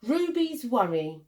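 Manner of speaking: ordinary delivery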